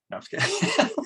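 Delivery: high, laughing through the words. Real, not acted. Teacher admits she was teasing the group, laughing. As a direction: giggle out the retraction.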